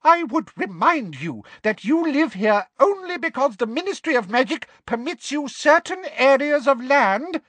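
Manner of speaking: very high-pitched, officious indignation; frightened, affronted official; indignant fright. A narrator's reading. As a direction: put them in their place in a high voice. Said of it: "with indignation".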